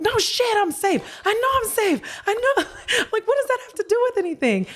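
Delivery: high, squeaky mock exasperation breaking into laughs; exasperated inner voice. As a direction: squeal it like you're fed up with being told the obvious. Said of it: "this is me in my head. I'm like".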